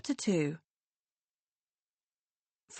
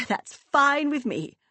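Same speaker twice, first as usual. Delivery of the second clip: breezy; 6 semitones higher, 4 dB louder, slower than usual. Acted. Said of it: "laugh lightly and say".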